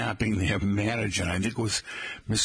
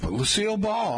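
ordinary talk

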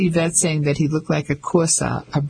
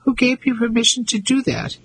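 haughty; 8 semitones higher than usual. A narrator's reading. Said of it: "She still sounded haughty".

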